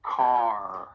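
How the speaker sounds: "Car" with a strong, held R at the end; "Car" ending in a pronounced American R; emphasized final R. Shown as a demonstration. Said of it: "really emphasize that R at the end".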